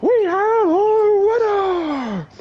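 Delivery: high, loud, long-drawn announcer call; fight announcer. Retold or imitated. Announcer over the fallen fighters, high drawn-out shout. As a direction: stretch it out to the whole arena. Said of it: "then the guy goes".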